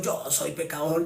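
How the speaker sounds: lightly voiced humble sinner admitting it; imitating a sinner's resigned confession; mock sinner.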